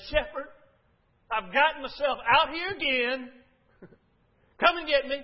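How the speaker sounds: loud, high desperate plea to the shepherd; lost soul shouting up to the shepherd for help; crying out a prayer. Pretend. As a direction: call out for rescue at full voice.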